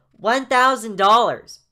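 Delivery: slow, high, booming announcement; loud high kid. Acted. Jaden telling Miss Walker the amount, loud kid voice. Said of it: "making his voice so inconsistent".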